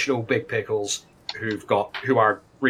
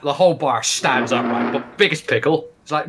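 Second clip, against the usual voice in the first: usual speaking voice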